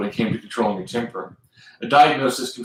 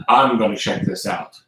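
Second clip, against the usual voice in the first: plain reading voice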